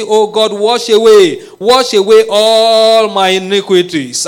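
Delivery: loud, high impassioned prayer shout; fervent pleading prayer, near shouting; preaching at full force. Acted. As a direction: cry out to God with all your strength.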